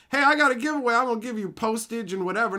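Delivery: hammed-up giveaway announcer; mock giveaway hype. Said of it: "I'm not mailing".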